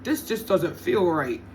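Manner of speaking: slow, lightly voiced ex-boyfriend; mild mock of the ex-boyfriend's slow line; quoting the ex. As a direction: drag it out like him, only a hint of a put-on voice. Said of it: "he says".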